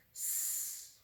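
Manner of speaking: whispered "S" hiss. Shown as a demonstration. Teacher hissing the letter sound "S". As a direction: let a quiet "S" hiss out. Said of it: "S says".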